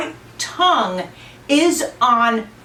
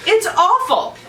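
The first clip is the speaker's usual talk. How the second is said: high excited complaining; 10 semitones higher than usual. Real, not acted. Reacting to hot pepper.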